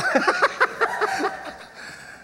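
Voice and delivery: a high, giggling burst of laughter; high fit of giggles. Real, not acted. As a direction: break into a squeaky fit of giggles. Boys laughing at the swearing.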